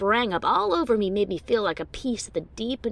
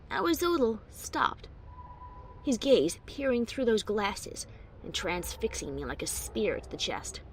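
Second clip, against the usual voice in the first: usual speaking voice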